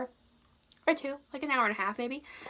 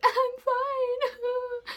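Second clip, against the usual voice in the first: high, tearful "I'm fine" through crying; 14 semitones higher, 4 dB louder than usual. Retold or imitated. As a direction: pretend you're fine while crying. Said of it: "sitting there crying".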